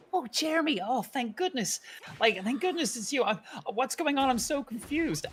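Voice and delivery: high-pitched, relieved then confused character voice; acted relief tipping into confusion; Evan. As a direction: plead in a high, bewildered voice. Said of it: "Evan's trying to communicate with him and he's like".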